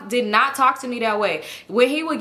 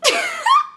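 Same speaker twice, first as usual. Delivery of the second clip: high-pitched squealing laugh; shrill retold giggle fit; burst of laughter; 4 dB louder than usual. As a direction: crack up at his sweet-talk. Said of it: "I just started laughing".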